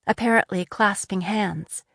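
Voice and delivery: plain reading voice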